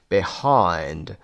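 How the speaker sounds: behind with HIND held and falling; stress on second syllable. Shown as a demonstration. Teacher giving an example to learners, second-syllable stress on behind. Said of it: "stressed on the second syllable".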